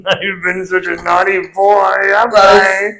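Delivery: hammed-up creepy naughty boy; creepy naughty child. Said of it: "Stop it, it's creepy".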